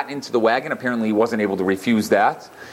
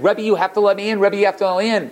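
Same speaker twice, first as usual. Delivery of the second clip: higher, lightly acted crying plea; begging through mild mock tears; tearful plea; 8 semitones higher than usual. Shut-out man begging the Rebbe, a touch of tearful pleading. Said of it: "He started crying".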